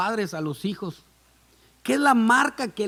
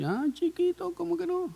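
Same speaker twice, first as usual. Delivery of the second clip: much higher playful mock voice; teasing; 11 semitones higher, 4 dB quieter than usual. Mother teasing her husband who promised to change, high playful. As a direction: tease him sweetly.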